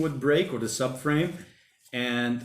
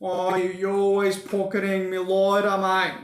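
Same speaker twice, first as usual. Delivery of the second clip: higher, broad Aussie cartoon character voice; mock Aussie cartoon bloke complaining to Lloyd; Aussie cartoon accent; 6 semitones higher than usual. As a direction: quote the show in a put-on broad Australian accent. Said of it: "in Aussie".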